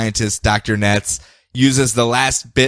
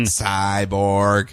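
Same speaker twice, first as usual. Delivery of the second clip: monotone robot voice; 4 semitones lower than usual. Pretend. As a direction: say it like a machine. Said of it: "Do you like my robot voice?".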